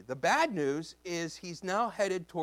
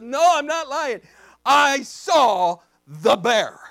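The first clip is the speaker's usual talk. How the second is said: loud, raised, deliberate declaration; emphatic insistence; 7 semitones higher, 13 dB louder, slower than usual. Retold or imitated. Pastor insisting to doubting junior highers, emphatic shout. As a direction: hammer it out, slowing word by word.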